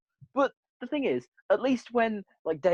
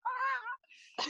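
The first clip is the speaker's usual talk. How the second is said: hammed-up high cry, much higher and softer; mock cry; 9 dB quieter than usual. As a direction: mimic a bad actor's feeble shriek. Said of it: "them two gods going".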